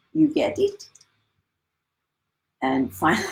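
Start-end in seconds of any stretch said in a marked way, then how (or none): 0.1-0.9 put-on high, French-accented question; imitating a French teacher's broken English. Mimicking the French teacher asking if she understood, French accent. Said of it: "saying to me in broken English".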